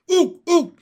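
very high, loud froggy hoots; two piercing, excited hoots; gleeful shout. A narrator's reading. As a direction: whoop it like a delighted frog. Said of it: "shouted Pin".

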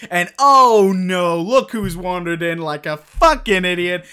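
normal talking voice

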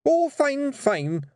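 high, flustered agreement; burbling. Acted. Rob placates his wife, burbling. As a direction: agree too eagerly, nervously. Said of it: "Rob burbled".

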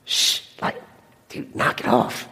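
whispered, irritated hush; shushing whisper. Retold or imitated. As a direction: hiss at him to be quiet. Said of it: "the people around him start going".

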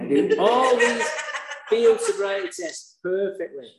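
high, laughing disbelief. Real, not acted. Telling the audience about the tests, laughing high. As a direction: laugh through the boast.

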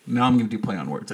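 usual speaking voice

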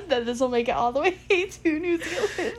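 high, loud, laughing through words; real giggles breaking up the sentence; laughing hard. Real, not acted. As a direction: barely get it out for laughing. Cracking up over imagined faraway listeners.

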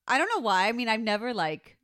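ordinary delivery

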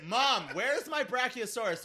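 put-on high, loud, slow kid's call; whiny kid calling mom.

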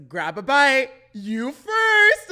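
high, loud, playful influencer voice; influencer imitation. Fan reenacting his favourite influencer's bit, playfully imitating. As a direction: pitch it up and project, mimicking his bit. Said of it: "he does that thing".

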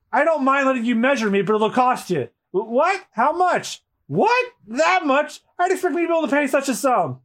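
high, quick bartering banter; haggling cartoon voices. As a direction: act out the greedy price haggle. Nami and author voiced.

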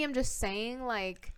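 ordinary delivery

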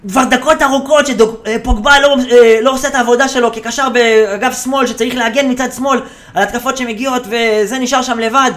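mimicked scolding shout; imitated pundit rant. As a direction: rant high and loud like an outraged commentator. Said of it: "הוא מיד הולך לצעוק על מישהו".

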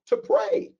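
"To pray." lifted high; emphatic answer. Real, not acted. Preacher calling out the answer to the congregation, emphatic. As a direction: ring out "To pray." to the room.

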